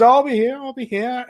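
high, loud laughing guard voice; guard's reply. Acted. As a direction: answer her with a grin. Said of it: "And he goes".

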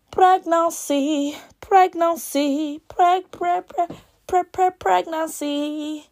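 slow, high, stuttering drumroll on pregnancy; dramatic stutter reveal. Pretend. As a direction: tease the word out like a big reveal.

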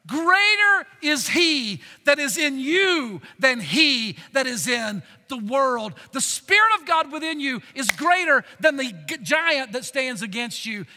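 slow, loud pulpit proclamation; full-force preaching. Acted. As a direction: preach it out, drawn and booming.

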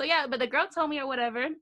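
usual speaking voice

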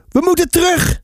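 high-pitched storybook cry of alarm; narrated shout. A narrator's reading. Finn to brother Sam on the raft, acted shout. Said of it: "schreeuwde Finn".

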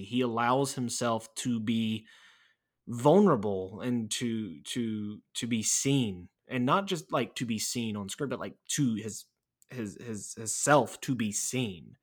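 ordinary talk, nothing marked

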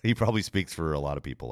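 ordinary delivery

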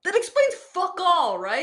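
high, loud, slow exasperation; loud drawn-out outrage; exasperated outburst. Real, not acted. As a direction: blurt out in disbelief. Outraged at the voicemail.

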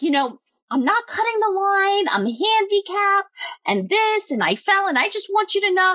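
high mocking voice of the line cutter; mimicking the woman. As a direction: imitate her whiny excuse, pitched high. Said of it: "She's like".

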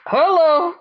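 laugh-cry the greeting back; overwhelmed hello. Retold or imitated. Answering the visitor, a high laughing, tearful hello.

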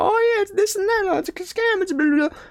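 high, whiny mocking voice of naysayers; mocking the critics. Mocking critics who call Kangen water a scam. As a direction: parody the critics in a squeaky sneer. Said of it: "They're talking about".

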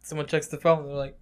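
usual speaking voice

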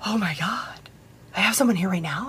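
whispered, stunned bride imitation; hushed disbelief. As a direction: drop to an awed near-whisper. Acts out stunned bride's reaction.

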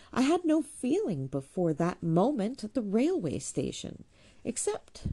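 winning reproachfulness. A narrator's reading. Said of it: "with all the winning reproachfulness".

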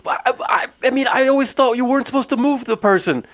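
high, hesitant worried voice; worried man. Retold or imitated. Robert doubting whether to move the injured man, high and worried. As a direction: doubt it nervously. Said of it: "He's like".

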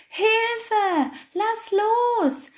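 very high, desperate cry for help; panicked cry. A narrator's reading. Hare grabbed by the ears, crying out in high panic. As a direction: squeal for your life. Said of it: "schrie der Angsthase in höchster Not".

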